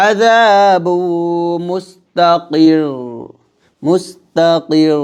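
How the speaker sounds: slow recitation, stretching মুস্তাকির; repeated recitation of মুস্তাকির; held r stretch. Shown as a demonstration. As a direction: draw out মুস্তাকির's final r. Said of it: "পরিমাণ সময় টান দেব".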